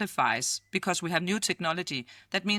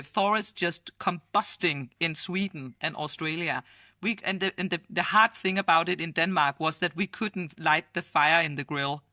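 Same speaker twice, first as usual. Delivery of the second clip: usual speaking voice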